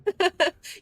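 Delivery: quick bright chuckle; short laugh. Real, not acted. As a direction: burst out laughing briefly. Reacting to own mistake.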